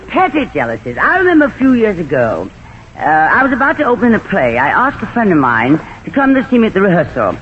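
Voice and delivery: usual speaking voice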